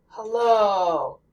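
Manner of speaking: hello drawn out slowly on the O; slow English hello. Shown as a demonstration. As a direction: stretch the O of hello. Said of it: "as you say the O sound".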